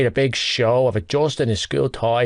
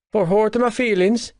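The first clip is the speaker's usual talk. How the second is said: sniffed; 10 semitones higher than usual. Acted. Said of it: "he sniffed".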